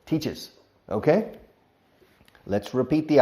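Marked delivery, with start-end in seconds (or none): 0.1-0.5 "Teaches" spoken as two clear syllables, tea-ches. Shown as a demonstration. Teacher modelling the added syllable in teaches. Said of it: "we add a syllable".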